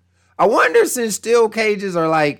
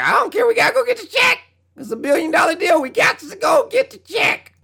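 mocking company voice; 13 semitones higher, faster than usual. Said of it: "they like".

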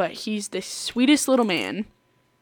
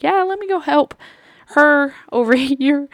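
uncomfortable excuse; 7 semitones higher, 5 dB louder than usual. Retold or imitated. Coworker escaping a ranting customer, uncomfortable. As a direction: imitate someone edging out of a conversation. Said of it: "really uncomfortable".

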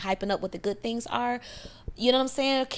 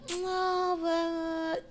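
mock high protest; 7 semitones higher, 4 dB quieter than usual. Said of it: "and then you're like".

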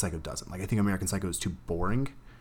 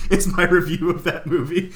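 laughing; 11 semitones higher, 8 dB louder than usual. Real, not acted. Laughing wrap-up of chant.

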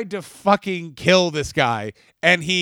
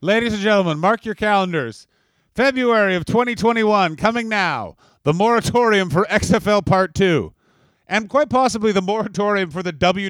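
mock announcer; 5 semitones higher than usual. Mock announcement of the next episode.

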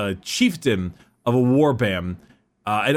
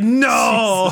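mock anguished no; 10 semitones higher, 4 dB louder than usual. Imagined Lorgar crying out.

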